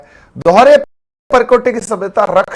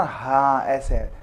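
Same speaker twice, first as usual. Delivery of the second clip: slow imitation; 5 semitones lower, 7 dB quieter, slower than usual. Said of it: "अभी तुम ऐसे बोला".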